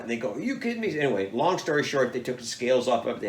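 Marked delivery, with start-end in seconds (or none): 0.3-1.0 much higher, tossed off in disbelief; incredulous. Retold or imitated. DNR staff doubt he caught it, high incredulous. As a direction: scoff like it must be a joke.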